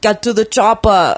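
loud Austrian-accented movie line; Arnold Schwarzenegger impression. Shown as a demonstration. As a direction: order it like an action hero, Austrian.

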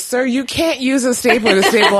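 giggling through the manager's words; laughing while quoting. Retold or imitated. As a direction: barely hold in the laugh. Manager's line retold, laughing.

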